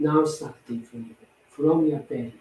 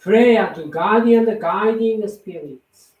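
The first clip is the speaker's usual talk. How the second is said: prayer; 5 semitones higher than usual. Acted. Recites a prayer aloud.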